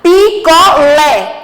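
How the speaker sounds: Picolé stretched syllable by syllable, LÉ strongest; slow teacher's sounding-out of Picolé, stressed LÉ. Shown as a demonstration. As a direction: draw out "Picolé" slowly, landing firmly on the final LÉ. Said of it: "O lé é a sílaba mais forte".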